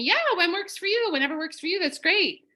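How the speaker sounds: high, overly available chirp; too-eager receptionist. Retold or imitated. Chiropractor's office eagerly offers any time, high and chirpy. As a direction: sound a bit too available. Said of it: "they express to me".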